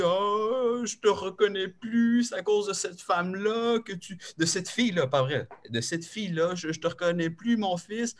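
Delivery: high, tearful mimicking of his mother; high tearful mother's plea; mother in tears. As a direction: whine it like his crying mother. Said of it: "ma mère m'appelle en larmes".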